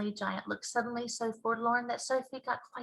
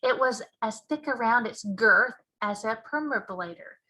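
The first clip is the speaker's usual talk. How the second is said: usual speaking voice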